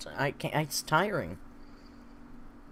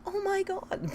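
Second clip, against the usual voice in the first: surprised; slower than usual. Real, not acted. Reacting to chat joke.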